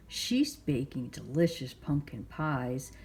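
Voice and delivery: plain reading voice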